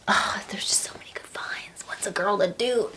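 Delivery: whispered, mock guilty aside. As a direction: whisper it like a naughty confession. Guilty aside about shopping.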